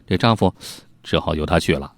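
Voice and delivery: ordinary delivery, nothing marked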